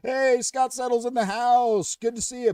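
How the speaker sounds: light up greeting a friend; excited greeting. Real, not acted. Host welcoming a viewer into the stream, excited and loud.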